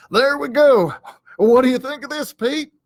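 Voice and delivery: high, eager show-off voice. Acted. Hank shows Pete his begging pose, high and eager.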